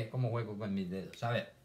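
ordinary delivery

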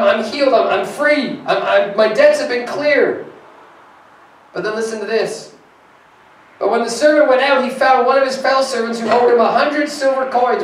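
full-force preaching. Acted. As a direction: lift the scripture with pulpit energy.

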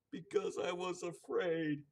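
high, loud, slow sobbing plea. Acted. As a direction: sob it out, drawn slow. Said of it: "sobbed the little man".

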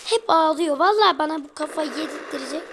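ordinary talk

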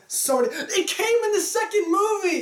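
high, loud excited outburst; excited shout. Real, not acted. Sharing a favourite movie moment, excited shout. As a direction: blurt it out thrilled.

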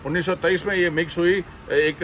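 ordinary delivery

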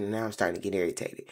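ordinary delivery

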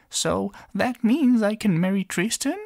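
tiny princess, happy and high; little girl voice. Acted. Princess pleading to king.

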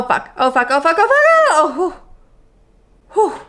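ordinary talk, nothing marked

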